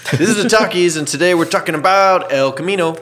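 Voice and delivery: high, loud announcer intro; hammy show host opening; exaggerated cartoon intonation.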